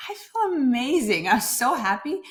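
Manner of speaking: gushing happiness. Retold or imitated. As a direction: tell your friend you feel amazing, beaming. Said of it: "And I was like".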